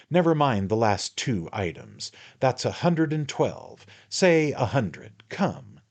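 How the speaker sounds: high, wheedling man-of-the-world patter; a hint of a knowing little lawyer; sly attorney, coaxing. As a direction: draw him in like a confidant. The attorney talks in the story.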